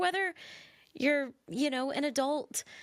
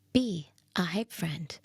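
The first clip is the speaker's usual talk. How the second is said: Delilah; 2 semitones lower than usual. Acted. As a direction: drop into a hushed, lingering radio voice. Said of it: "I have to do my Delilah radio voice".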